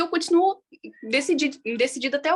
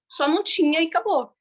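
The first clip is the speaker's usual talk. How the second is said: ordinary talk, nothing marked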